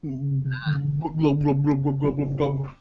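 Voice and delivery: soft gurgling fish glubs; gurgling fish man croak. Pretend. Silly fish noises.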